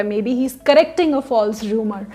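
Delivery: ordinary talk, nothing marked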